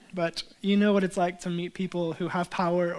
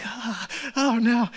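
mild panic; 3 semitones higher, slower than usual. Retold or imitated. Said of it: "I'm starting to have a little panic attack".

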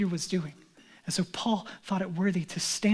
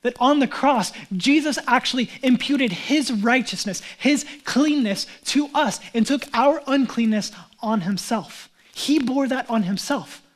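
fervent sermon delivery; preaching at full force; 3 semitones higher, 6 dB louder than usual. Acted. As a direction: proclaim it to the room. Preaching climax.

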